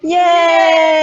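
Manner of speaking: loud cheer. Acted. Cheering shout.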